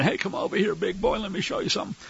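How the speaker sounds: soft, fast come-hither call; seductive lure. Retold or imitated. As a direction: lure him in quietly. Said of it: "the ones that are saying".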